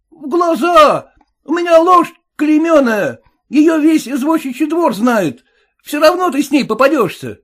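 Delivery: high suspicious cabbie; cab driver voice. Acted. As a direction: play the sly cabbie, pitched well up.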